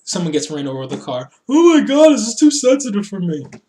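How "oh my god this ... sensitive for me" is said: high, loud hammed-up distress; mock horror. Host mocking viewers who find the car segment too much, mock horror. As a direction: wail it as an offended viewer, mocking.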